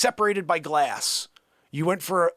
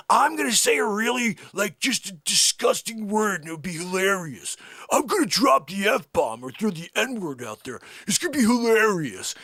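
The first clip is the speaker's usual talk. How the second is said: raised, over-eager parody voice; mocking wannabe joker; 4 semitones higher than usual. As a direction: mimic someone who thinks he's hilarious. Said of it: "they're like".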